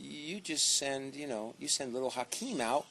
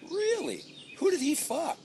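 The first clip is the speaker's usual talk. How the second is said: incredulous scoff. Acted. Scoffing at creation myth.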